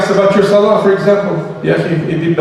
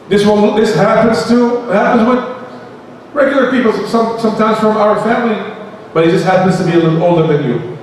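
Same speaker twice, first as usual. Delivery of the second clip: ordinary talk, nothing marked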